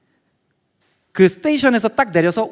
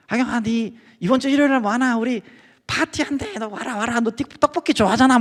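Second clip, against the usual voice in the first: put-on pushy older guy in a light Daegu accent; hint of Daegu, nagging him to church; Daegu dialect; 5 semitones higher than usual. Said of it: "대구에서 온 사람이었는데".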